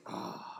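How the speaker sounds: voiceless exasperated exhale; frustrated breathy sigh. Retold or imitated. Host seeing the rival team, exasperated sigh. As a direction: sigh out the irritation. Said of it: "I see them just like".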